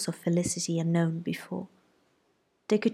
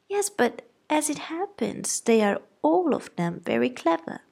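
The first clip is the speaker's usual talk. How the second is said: high-pitched fretting mother; flustered wife defending her girls; fussy mother; 8 semitones higher than usual. A narrator's reading. Mrs Bennet voiced.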